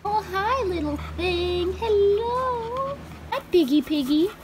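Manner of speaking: high, soft cooing at kittens. Real, not acted. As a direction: coo sweetly at a kitten.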